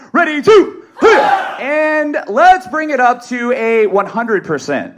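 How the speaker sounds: drawn-out, high shouted count; loud yell. Shown as a demonstration. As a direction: drill leader pushing the room to full power. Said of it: "let's be loud. Let's yell".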